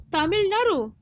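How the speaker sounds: normal talking voice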